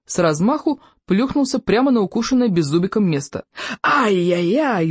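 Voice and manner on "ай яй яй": slow, drawn-out wail; howl of pain. A narrator's reading. Bully lands on his bitten backside, pained howl. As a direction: stretch out a pained, wavering howl. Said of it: "взвыл он".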